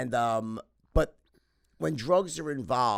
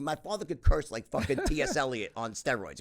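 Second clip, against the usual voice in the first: usual speaking voice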